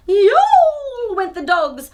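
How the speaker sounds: loud, slow, drawn-out howling yowl; loud mock dog howl. A narrator's reading. Reading animal noise with gusto.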